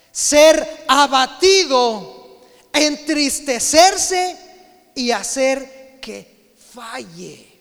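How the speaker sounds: loud, very slow, drawn-out preaching; fervent preaching. Real, not acted. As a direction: stretch every word for weight. Preaching at full force.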